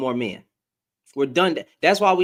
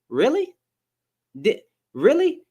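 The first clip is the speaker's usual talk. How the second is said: incredulous; 8 semitones higher than usual. Real, not acted. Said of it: "incredulous rise".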